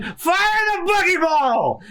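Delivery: very high, drawn-out battle order; yelling command. Acted. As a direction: scream the firing order, stretching the last word. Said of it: "Buggy is yelling".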